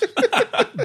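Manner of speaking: high squeal of a shout; mock high shriek. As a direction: shriek like a yelling manager. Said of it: "does some shouting".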